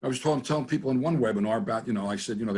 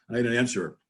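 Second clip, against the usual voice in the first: normal talking voice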